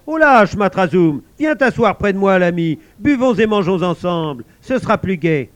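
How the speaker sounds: lightly voiced cheerful guard.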